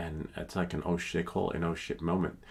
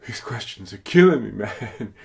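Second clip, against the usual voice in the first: mock exasperated outburst; 3 semitones higher, 12 dB louder than usual. Drone test-taker moaning about killer questions, loud mock exasperation. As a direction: blurt it out in mock agony.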